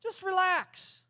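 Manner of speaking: slow sarcastic. Shown as a demonstration. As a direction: stretch it out, mockingly sweet. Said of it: "That fixes things, doesn't it?".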